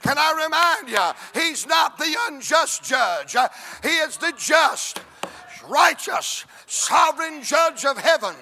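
loud, drawn-out pulpit cadence; fervent revival preacher shouting; full-force preaching. Acted. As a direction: preach it, rising and pounding.

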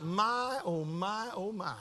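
very soft, drawn-out murmur; murmured listening. Retold or imitated. Pastor responds to a man's troubles, soft murmur. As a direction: voice absent-minded sympathy under the breath.

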